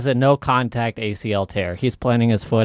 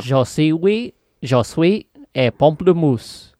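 slow mock French with an American accent; American speaking French; 4 semitones higher, slower than usual. Joking at the podcast in put-on French, American accent. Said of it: "You sound like an American speaking French".

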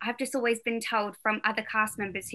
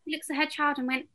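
ordinary delivery

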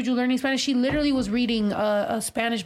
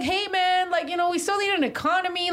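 higher-pitched, pleading objection; retold objection; 6 semitones higher than usual. Retelling a word to the hippies, high protest. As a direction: raise the pitch to reason with someone.

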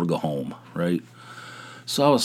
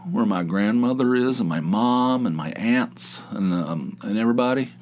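normal talking voice

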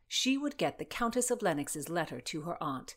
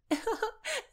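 put-on high, girlish giggle. A narrator's reading. Christina teasing Constance, giggling. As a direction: let out a high teasing titter.

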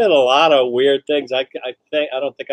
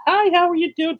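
put-on much higher, sugary greeting to a little girl; sweet high greeting. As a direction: lift way up, sweet for a child. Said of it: "he says".

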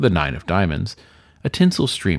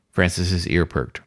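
usual speaking voice

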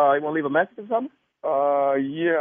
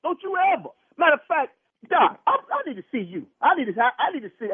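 high, agitated rebuke; real fury; 11 semitones higher than usual. Real, not acted. Husband rejecting the doctor's playful excuse, furious. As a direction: shut down the excuse, voice pitched up.

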